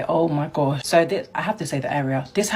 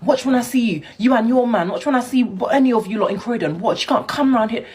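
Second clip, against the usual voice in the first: retold angry threat; 7 semitones higher than usual. Street guy threatening her after rejection, mock shouting. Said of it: "My man started shouting in my face".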